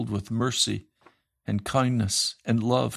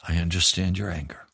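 much lower, gentle counsel; tender pastoral reply; 4 semitones lower than usual. Retold or imitated. Pastor gently counselling the angry young man, low and tender. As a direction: soothe him like a caring pastor. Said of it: "I said".

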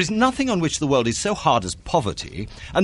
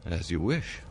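cold resignation; 8 semitones lower, 9 dB quieter than usual. Acted. Accepting the broken engagement.

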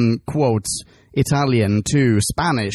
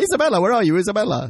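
mock calling out; 7 semitones higher, faster than usual. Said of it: "cut to two hours later".

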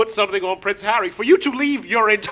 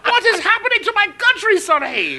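high, loud incredulous cry; mock outrage; 8 semitones higher, 5 dB louder than usual. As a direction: wail it in exaggerated dismay, laughter breaking in. Mock outrage at royal news.

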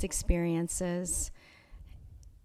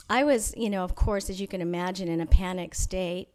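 ordinary talk

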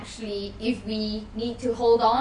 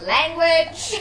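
mock shout; 7 semitones higher, 8 dB louder than usual. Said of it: "I just shout".

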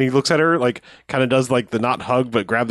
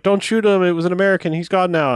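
higher-pitched, lightly voiced child; kid; 6 semitones higher than usual. Kid pleading with the villain not to shoot, lightly imitated kid voice. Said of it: "a kid is like".

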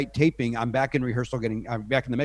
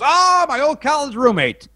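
delighted; 13 semitones higher, 6 dB louder than usual. Retold or imitated. Ted Danson's delighted reply.

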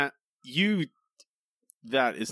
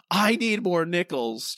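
loud, higher, drawn-out imitation yell; mock yell; 6 semitones higher, 3 dB louder than usual. Retelling a man yelling as loud as he can, mock yell. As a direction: imitate a man hollering, stretching the words. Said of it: "That man yelling".

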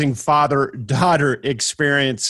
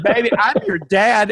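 emotional reassurance; 7 semitones higher than usual. Retold or imitated. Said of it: "I said".

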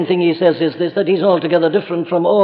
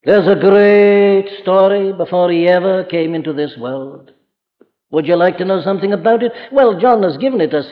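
ordinary talk, nothing marked

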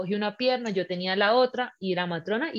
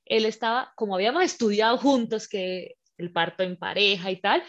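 usual speaking voice

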